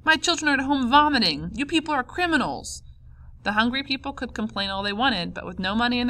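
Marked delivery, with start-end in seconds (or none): 0.0-2.8 high, loud accusing shout; angry outcry. A narrator's reading. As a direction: cry out in outrage from the mob. Angry mob confronts the traders.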